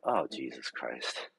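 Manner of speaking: low, slow, soft "Oh, Jesus Christ"; weary disbelief; exasperated sigh. Retold or imitated. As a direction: let it sink out of you, fed up. Exasperated reply.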